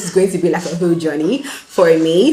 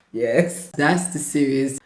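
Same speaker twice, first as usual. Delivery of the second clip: ordinary talk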